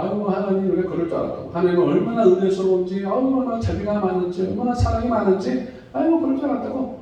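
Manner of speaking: sarcastic Jonah, rising high and hushed in fake praise. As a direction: sneer while pretending to praise God. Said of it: "비꼬는 겁니다".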